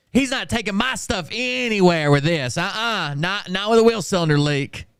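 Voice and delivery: mocking kid.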